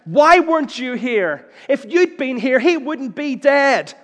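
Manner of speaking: loud, high, slow anguished cry; anguished accusation. Shown as a demonstration. Martha beating Jesus' breast, loud anguished cry. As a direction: pound the words like fists on his chest. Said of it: "she would beat his breast".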